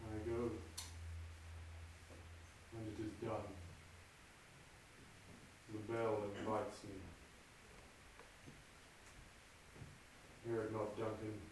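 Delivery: low, soft brooding murmur to himself; hushed soliloquy. Acted. Macbeth alone before the murder, low and hushed.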